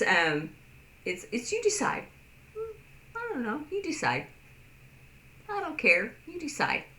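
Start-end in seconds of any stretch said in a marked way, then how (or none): 1.5-6.8 high, airy "you decide"; carefree deferring. Shown as a demonstration. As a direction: let it go with a shrug, pitched up. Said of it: "demo of carefree use".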